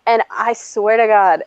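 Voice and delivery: usual speaking voice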